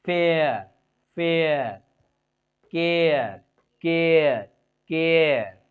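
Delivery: "एयर" as ए gliding into अ; pronunciation drill. Shown as a demonstration. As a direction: drill फेयर and केयर loudly, each falling. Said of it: "ए, अ".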